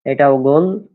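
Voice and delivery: measured recitation of Arabic letters, each said three times. Shown as a demonstration. Teacher drilling the alphabet for online students, clear recitation.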